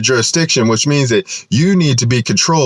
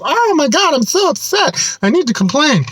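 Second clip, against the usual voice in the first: much higher, whiny mock voice; mock the complaining public citizen, high and whiny; whiny complainer. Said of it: "public citizens complain. They just complain".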